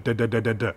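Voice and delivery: quick staccato duh bursts; machine gun, front of call. Shown as a demonstration. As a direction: rattle the duhs from the front. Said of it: "This is like a machine gun".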